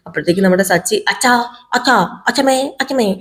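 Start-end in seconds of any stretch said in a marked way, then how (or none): 1.1-3.1 acted call to family members. Grandson calling father and grandmother as he goes over, calling out. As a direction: call out in short, separate bursts. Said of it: "എന്ന് വിളിച്ചോണ്ട് നേരെ പോവുകയാണ്".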